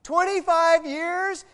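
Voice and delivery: loud, high, slow exclamation of disbelief; comic outrage. Retold or imitated. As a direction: cry it out, dragging each word. Comic outrage in marriage anecdote.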